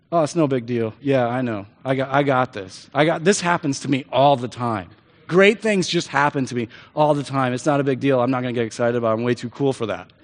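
low, offhand fake cool; too-cool act. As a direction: shrug it off like it's nothing. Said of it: "Act like you've been there before".

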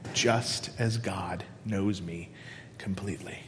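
fading whisper. Acted. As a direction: let the verse die into a whisper. Verse ending fades to whisper.

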